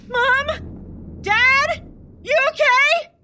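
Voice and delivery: high worried calls up the stairs; calling out. Acted. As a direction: call out to parents upstairs.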